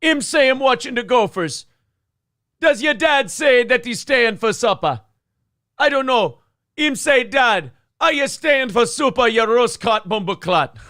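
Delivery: high, loud Jamaican patois over a Minnesota lilt; Jamaican Fargo accent. Acted. Spinning a bartending yarn in a Jamaican Fargo accent, high and loud. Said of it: "Jamaican Fargo".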